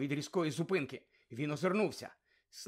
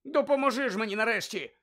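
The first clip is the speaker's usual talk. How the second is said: higher, louder exasperated appeal; furious demand; 6 semitones higher, 5 dB louder than usual. A narrator's reading. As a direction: snap for help at last. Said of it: "Він люто озирнувся на Крума". (люто, з докором)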